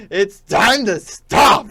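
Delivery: Filthy Frank shout. Retold or imitated. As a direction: bark it like an angry meme guy. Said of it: "And then he's like".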